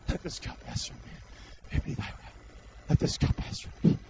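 hushed, voiceless acted plea; whispered prayer. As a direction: whisper a private plea to the Father. Acts out Jesus praying alone.

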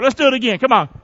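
ordinary talk

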